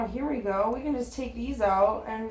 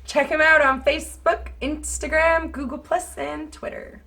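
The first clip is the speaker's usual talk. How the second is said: put-on broad Canadian accent, high and loud; 9 semitones higher, 10 dB louder, slower than usual. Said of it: "do it in your broadest Canadian accent".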